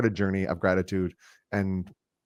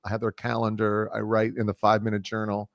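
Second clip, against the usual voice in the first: normal talking voice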